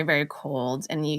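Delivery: ordinary talk, nothing marked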